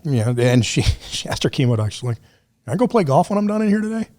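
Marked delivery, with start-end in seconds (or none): 2.7-4.1 high, quick retold question; eager asking. Mom to her chemo doctor, retold eager question. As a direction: lightly imitate a patient asking permission.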